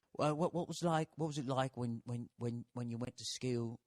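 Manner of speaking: lightly put-on Australian interviewer voice; mock Australian accent, softly; brutal Aussie accent. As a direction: ask it like an Aussie TV host, a bit overdone. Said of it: "brutal fucking Aussie accent".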